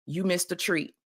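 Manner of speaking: ordinary delivery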